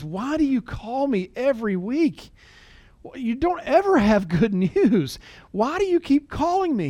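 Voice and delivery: high, drawn-out exasperated imitation. Widow to the lawyer who keeps calling, exasperated. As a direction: act out fed-up pleading, pitched well up. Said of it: "finally, in exasperation, she says".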